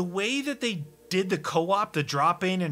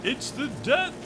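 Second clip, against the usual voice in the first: slow, high booming game announcer; 10 semitones higher, slower than usual. Retold or imitated. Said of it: "a big old announcer voice".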